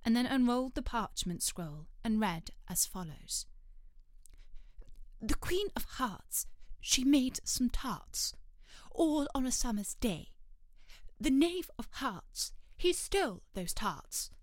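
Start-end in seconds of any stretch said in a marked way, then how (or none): 5.2-14.3 slow, sing-song rhyme reading; recited verse. Acted. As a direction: read it out like a herald's proclamation. Herald reads the accusation verse.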